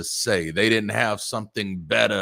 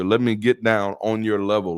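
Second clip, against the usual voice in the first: ordinary talk, nothing marked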